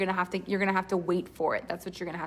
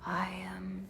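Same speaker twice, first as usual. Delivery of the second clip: breathy mystic hush; spiritual teacher whisper; 4 semitones lower, 13 dB quieter than usual. Shown as a demonstration. Coach demos the spiritual teacher voice, whispered. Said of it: "The spiritual teacher's like".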